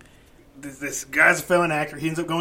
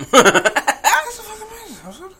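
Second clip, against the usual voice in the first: high, loud, slow laughing shock; laughing disbelief; 13 semitones higher, 8 dB louder than usual. Retold or imitated. Retelling his reaction to a friend: loud laughing disbelief. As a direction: belt it out in amused disbelief.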